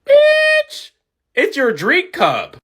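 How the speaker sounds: sharp high "Bitch" flung out, then slow and cutting; sassy retort. Retold or imitated. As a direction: snap back at him, then drag the rest out. Clerk snaps at rude customer.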